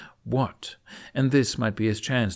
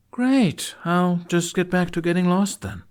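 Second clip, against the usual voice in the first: sarcastic; 9 semitones higher, 6 dB louder than usual. A narrator's reading. Jack mocking the knight.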